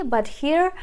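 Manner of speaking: ordinary talk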